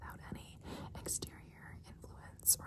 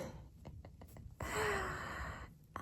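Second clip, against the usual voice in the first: whispered laugh; 10 semitones higher than usual. Acted. Laughs after praise.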